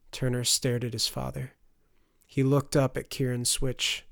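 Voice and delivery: ordinary delivery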